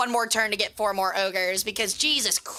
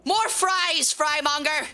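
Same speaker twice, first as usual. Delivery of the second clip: mock archaic lord; 4 semitones higher, slower than usual. Continues mock archaic speech.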